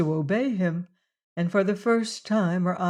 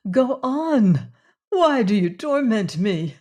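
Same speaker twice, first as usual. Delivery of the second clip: desperate; 4 semitones higher, 5 dB louder, slower than usual. Acted. Desperate woman demands the truth.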